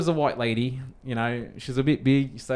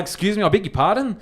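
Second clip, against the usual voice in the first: higher-pitched, mock-polite affront; civilized indignation; 8 semitones higher, 4 dB louder than usual. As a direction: put on an over-polite challenge. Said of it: "very civilized, which is not naturally me".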